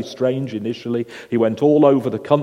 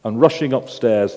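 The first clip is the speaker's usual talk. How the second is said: usual speaking voice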